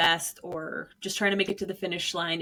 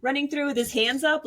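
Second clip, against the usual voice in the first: ordinary delivery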